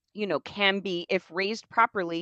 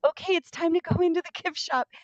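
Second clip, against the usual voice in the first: mock parent voice, high; 11 semitones higher, faster than usual. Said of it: "they were like".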